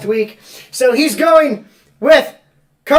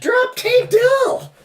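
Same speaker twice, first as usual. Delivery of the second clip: incredulous; 11 semitones higher, much slower than usual. Real, not acted. Disbelief at the drop.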